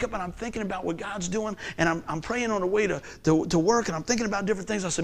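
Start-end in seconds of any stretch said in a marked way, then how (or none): none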